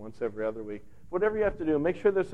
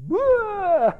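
loud, much higher hammed-up crying wail; mock wail; 9 dB louder than usual. Becky bursts out crying at her neighbor, mock wail. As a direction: burst into a comic sob.

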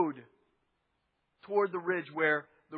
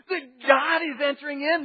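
excited shout; 5 semitones higher, 4 dB louder than usual. Acted. David dancing before the Ark.

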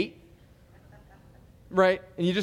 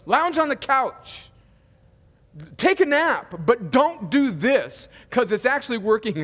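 higher and louder, exaggerated send-off; exasperated riff; 4 semitones higher, 3 dB louder than usual. Preacher voicing God sending church home, mock exasperated. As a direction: fling it out: just go home.

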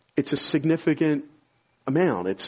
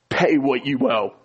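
cold, demanding "Pay what you owe"; hard-hearted demand; 3 dB louder than usual. Retold or imitated. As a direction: say "Pay" as a flat, unforgiving demand. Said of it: "It's a very hard-hearted phrase".

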